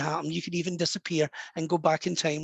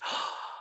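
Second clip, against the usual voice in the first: soft acted gasp of astonishment; amazed gasp; 11 dB quieter than usual. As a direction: gasp as if suddenly recognising someone. Retold grandmother's amazement.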